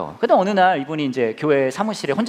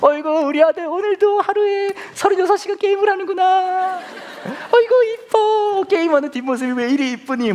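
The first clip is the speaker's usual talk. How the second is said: hammed-up doting father; 5 dB louder than usual. Father cooing at son's gaming, mock adoring. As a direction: coo over him like a baby.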